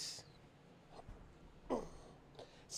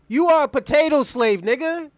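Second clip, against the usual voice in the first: loud taunting yell; shouted insult; 4 semitones higher, 7 dB louder than usual. Real, not acted. Streamer yelling an insult at his debate opponent. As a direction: yell it right in his face.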